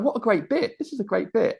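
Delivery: high-pitched, impressed onlooker voice; mock delighted audience. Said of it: "everyone's like".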